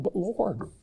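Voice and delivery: a prophet's hushed whine; pleading prophet. Retold or imitated. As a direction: plead up to the Lord, bewildered. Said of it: "And he's going".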